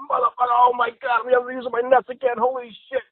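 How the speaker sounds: high, strained cry of pain; agonized outcry. Acted. Man kicked in the nuts goes down, high agonized outcry. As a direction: wail it out in mock agony.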